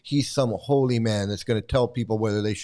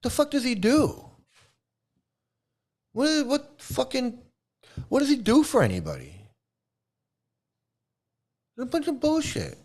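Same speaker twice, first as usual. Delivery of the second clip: exasperated; 11 semitones higher than usual. Real, not acted. Exasperated rant about the preacher.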